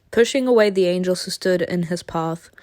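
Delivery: normal talking voice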